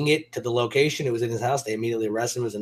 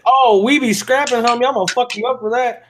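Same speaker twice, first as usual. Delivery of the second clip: mock threat; 8 semitones higher, 5 dB louder than usual. Real, not acted. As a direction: hype it up like you're ready to fight. Reacting to stolen wallet story.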